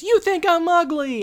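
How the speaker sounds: high, loud hurt cry; upset outburst. Retold or imitated. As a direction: fling it out as she runs away. Topanga runs off upset.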